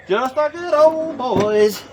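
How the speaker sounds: high, slow, stretched-out brag; excited drawl. Real, not acted. Crawling through the window.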